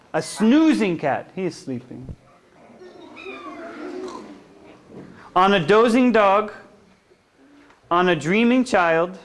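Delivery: sing-song picture-book reading; gentle read-aloud for little kids; reading to children. Acted. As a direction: lull them along the bedtime rhyme.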